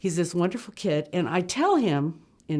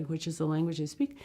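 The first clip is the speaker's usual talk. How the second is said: normal talking voice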